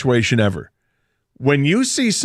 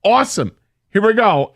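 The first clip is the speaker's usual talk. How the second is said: imagined glee; 9 semitones higher than usual. Retold or imitated. Driver finally an eyewitness to justice, gleeful anticipation. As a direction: savour it in a hammed-up excited voice.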